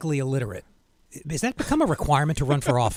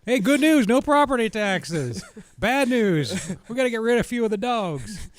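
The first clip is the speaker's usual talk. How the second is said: higher-pitched, hammed-up Panhandle drawl; Florida Panhandle accent; 5 semitones higher, slower than usual. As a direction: play a Florida Panhandle yokel announcing the news. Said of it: "I don't know why I use that accent".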